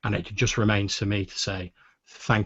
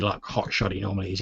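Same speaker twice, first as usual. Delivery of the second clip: usual speaking voice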